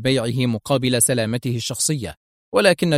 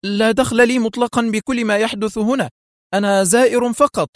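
high-pitched, pleading protest; acted panicky disclaimer; hurried cry; 10 semitones higher than usual. A narrator's reading. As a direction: blurt out your innocence in a high voice. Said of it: "وأسرع حايم يصيح".

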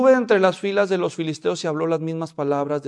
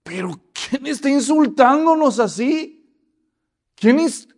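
higher, louder outraged question; indignant surprise; 7 semitones higher, 7 dB louder than usual. Acted. David asking indignantly who is insulting Israel. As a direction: demand who dares insult them.

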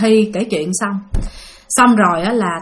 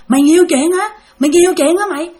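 acted-out cursing, rising higher each time; retold scolding; 6 semitones higher than usual. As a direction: imitate an angry man's taunting accusation, high-pitched. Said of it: "Ông chửi Thi vậy đó".

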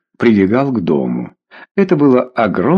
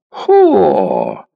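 drawn-out mocking "ho!"; jeering, stretched-out exclamation; scornful exclamation; 3 semitones higher than usual. A narrator's reading. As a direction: exclaim it like an offended drunk. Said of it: "воскликнул Ворона".

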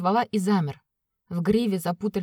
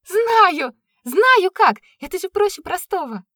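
high, loud cry of delight; joyful shout; 11 semitones higher, 8 dB louder, slower than usual. Acted. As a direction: shout it out with glee. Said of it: "закричала она от радости".

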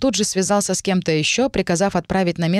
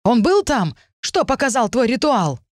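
panicked questions; 6 semitones higher than usual. A narrator's reading. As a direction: press for answers in panic. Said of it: "в чьих глазах появилась паника".

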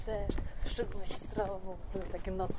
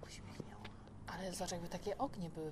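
quiet at night; 3 semitones lower, 10 dB quieter than usual. Real, not acted. Walking outside at night.

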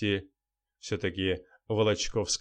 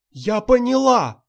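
high, loud, slow exclamation; sudden cry; 13 semitones higher, 11 dB louder than usual. A narrator's reading. Actress crying out in sudden realization to the journalist. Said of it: "вдруг вскрикнула Волочковская".

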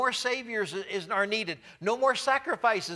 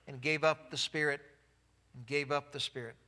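"gave up the spirit" echoed lower and much softer; fading repeat; 5 semitones lower, 6 dB quieter than usual. Acted. Preacher dwelling on Jesus' last breath, fading softly. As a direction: let it fade to near silence.

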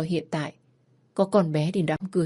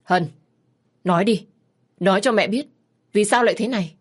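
a mother demanding answers, louder and pressing; upset mother; 3 semitones higher, 5 dB louder than usual. Acted. Mother pressing her daughter for the truth, louder and anxious. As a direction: push her daughter to speak, anxious and stern.

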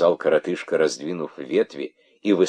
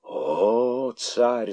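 hissing; 7 semitones higher than usual. Acted. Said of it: "зашипели старые удавы".

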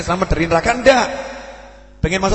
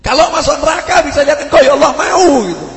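loud, raised preaching climax; preaching full force; 6 semitones higher, 7 dB louder than usual. Real, not acted. Preacher stirring the congregation, loud and raised. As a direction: drive it home loud to the crowd.

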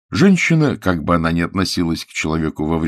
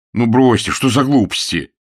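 loud, brisk dismissal in a raised pitch; putting on bravado; 5 semitones higher, 5 dB louder than usual. A narrator's reading. Man makes light of being jilted, with bravado. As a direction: brush it off boldly. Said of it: "продолжал храбриться он".

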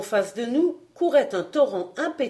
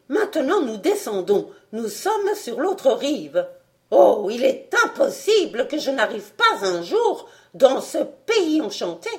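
high, loud, giddy enthusiasm; mad scientist; 5 semitones higher, 4 dB louder than usual. A narrator's reading. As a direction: rave about your own genius. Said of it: "s'exclama le docteur".

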